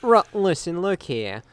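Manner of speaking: a light Boston twang; hint of a Boston tough guy; Boston accent. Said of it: "You became Bostonian for a second".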